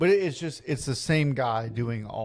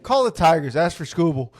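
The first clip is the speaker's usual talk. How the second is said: louder boss command; loud mock order; 4 semitones higher, 7 dB louder than usual. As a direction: bark the next order. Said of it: "He said".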